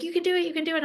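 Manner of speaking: high cheering encouragement; cheering friend. Retold or imitated. Friend Toby cheering her on the slope, high. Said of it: "but she's like".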